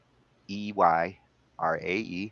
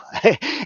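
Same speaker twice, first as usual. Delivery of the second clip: bright laugh; 13 semitones higher, 7 dB louder than usual. Real, not acted. Host laughing.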